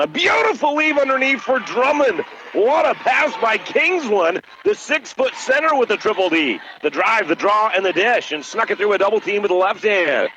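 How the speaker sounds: high, thrilled play-by-play over a great pass; excited call. Real, not acted.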